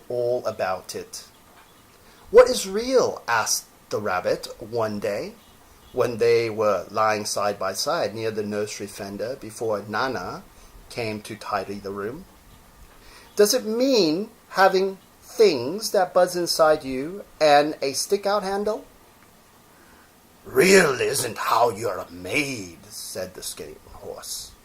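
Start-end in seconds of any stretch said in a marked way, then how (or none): 2.3-3.2 high, eager little question; rabbit's small voice. Acted. Rabbit asking the old horse, high and eager. 13.4-18.9 rabbit voice. Acted. Rabbit voiced asking question. 20.5-22.8 put-on old horse voice. Skin Horse voiced answering.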